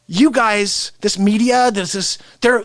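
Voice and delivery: ordinary talk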